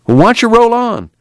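impatient, high-pitched challenge. A narrator's reading. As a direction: push him to get going, pitch jumping up. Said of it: "Tom said impatiently".

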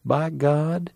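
hint of a bitter country man; aggrieved one-eyed man. One-eyed man airs his grievance.

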